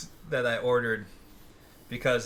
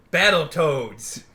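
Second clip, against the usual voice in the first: slow, loud hyped name; dramatic reveal; 3 semitones higher, 8 dB louder, much slower than usual. Acted. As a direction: draw the name out with hype.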